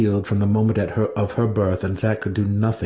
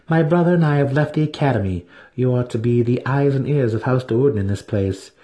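proud priestess; 5 semitones higher than usual. Acted. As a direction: speak as a proud high priestess. Vienna's voice in the story.

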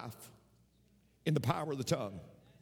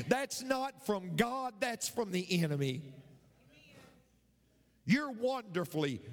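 ordinary talk, nothing marked

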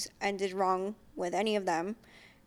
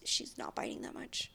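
breathy little secret; whispered aside; 12 dB quieter than usual. Real, not acted. Whispering to viewers about the puppy. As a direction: whisper it to the camera.

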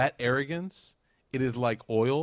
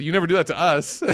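high, loud laughing complaint; a jealous master complaining, laughing; 8 semitones higher, 11 dB louder than usual. Retold or imitated. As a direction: tease it through a laugh.

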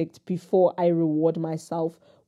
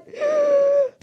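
high gasp catching her breath; sharp gasp; 4 dB louder than usual. Real, not acted. Composing herself after crying on camera, sharp gasp. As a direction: suck in air after tears.

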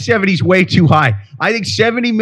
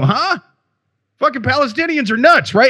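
high, mocking dumb-guy voice; sarcastic mock voice, high; mock dumb settler; 8 semitones higher than usual. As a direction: play the clueless settler, pitched way up. Mocking settlers blaming Palestinians.

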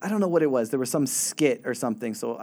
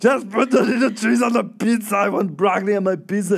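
put-on Arnold Schwarzenegger accent; 7 semitones higher, 6 dB louder than usual. Caller insisting on broccoli to the pizza clerk, in an Austrian accent. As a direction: bellow it in a hammed-up Austrian accent. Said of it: "the Arnold Schwarzenegger accent".

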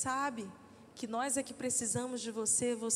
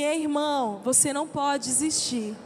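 encouraging call; 7 dB louder than usual. Shown as a demonstration. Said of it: "diz assim".

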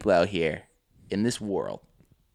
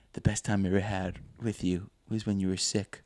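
soft, low acted voice; imitating an intimate film line; hushed movie confession; 4 semitones lower, 8 dB quieter than usual. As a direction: confess it quietly, as in a drama. Voicing wife's intimate confession.

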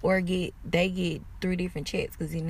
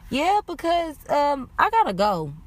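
awkward escape; 8 semitones higher, 5 dB louder than usual. Retold or imitated. Awkward exit when read perfectly.

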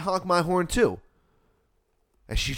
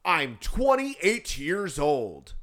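higher, very drawn-out mock protest; indignant man; 4 semitones higher, much slower than usual. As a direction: imitate a whining grown man. Acts out man's indignant reply.

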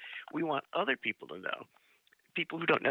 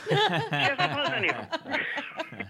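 laughing; 12 semitones higher, 4 dB louder, slower than usual. Real, not acted. Punchline laughed out.

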